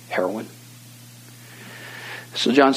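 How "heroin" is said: low, very soft single-word admission; hushed confession. Retold or imitated. Young man naming his worst habit, hushed and low. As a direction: imitate a reluctant, ashamed reveal.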